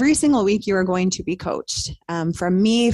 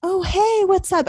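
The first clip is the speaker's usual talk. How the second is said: high, chirpy hello to a stranger; bubbly friendly greeting; 12 semitones higher than usual. Retold or imitated. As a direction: greet a new mom brightly. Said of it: "I would be the one at the park to be like".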